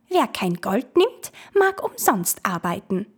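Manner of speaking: wisps taunting the ferryman; will-o'-wisp voice. Acted. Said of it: "riefen die Irrlichter".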